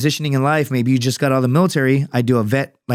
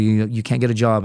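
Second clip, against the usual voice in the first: ordinary delivery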